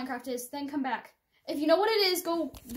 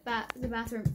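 ordinary talk

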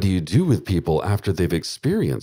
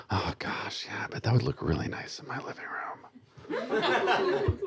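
much softer whisper, laughing through it; giggly whisper; 5 semitones higher, 9 dB quieter than usual. Real, not acted. Host to listeners, admiring a grisly lamp, giggly whisper. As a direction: hush it like a guilty pleasure. Mock-delighted aside about decor.

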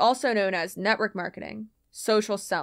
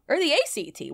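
usual speaking voice

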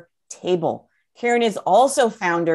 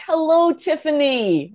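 high, drawn-out hello; delighted greeting; 8 semitones higher than usual. Real, not acted. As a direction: call out a happy, sing-out greeting. Greeting an attendee by name.

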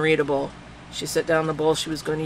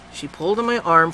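ordinary delivery

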